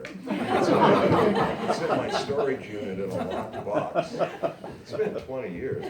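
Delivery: words stretched out through real laughter; laughing. Real, not acted. Reader cracking up at own manuscript. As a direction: laugh helplessly while reading. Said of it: "Why didn't I write a word there".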